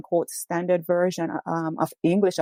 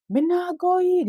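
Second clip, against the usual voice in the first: much higher, pleading voice of a man in distress; retold plea in Jamaican patois; 12 semitones higher than usual. Arrested Jamaican man protesting to police, high frightened plea. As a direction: echo the arrested man's frightened cry. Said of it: "And the man was saying".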